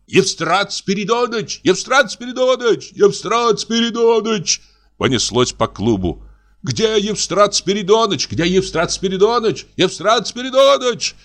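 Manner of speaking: high calls across the club; calling out. Acted. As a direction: voices calling for the old policeman. Calling for the policeman.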